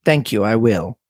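put-on European-accented mad scientist, lightly done; Doctor Bauerstein. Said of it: "European mad scientist".

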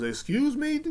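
high, stunned question; shocked excuse me. Retold or imitated. Woman shocked by the customer's line, high. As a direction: gasp it out in disbelief. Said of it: "And she goes".

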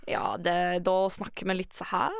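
singsong Norwegian, rising pitch; cheerful Norwegian lilt going up; Norwegian accent. Shown as a demonstration. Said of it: "I can do the Norwegian".

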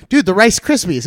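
high, loud reminder to a friend; calling out. Retold or imitated. Friend to comic coming offstage, excited calling out. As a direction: imitate a buddy yelling out a reminder. Acts out friend's call after set.

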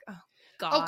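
whispered, breathy "oh God" of despair; exasperated whisper. Retold or imitated. Stuck on hold with the cable rep, a despairing whisper. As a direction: whisper it like you can't believe it. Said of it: "And I'm just like".

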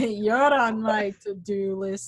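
slow, drawn-out poster-line voice; mock catchphrase delivery. Hosts mocking Shrek's catchphrase, slow and hammy. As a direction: sell it like a movie tagline.

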